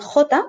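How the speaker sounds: J named "KHO-ta" with throaty first sound; J with a back-of-throat scrape to start; guttural Spanish J. Shown as a demonstration. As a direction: say J as the Spanish letter jota. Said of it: "always pronounced like this".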